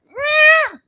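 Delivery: high squeaky meow; cat meow. Acted. Cat coming down the stairs.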